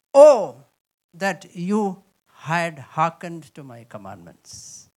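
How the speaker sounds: loud, drawn-out Oh then a slow sigh of regret; lamenting God. Acted. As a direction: grieve over the people. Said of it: "He says".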